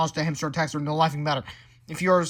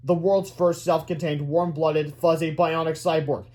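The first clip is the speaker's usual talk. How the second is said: plain reading voice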